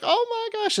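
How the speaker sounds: very high-pitched mock "oh my gosh"; amused disbelief. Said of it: "Just cracking up laughing at him".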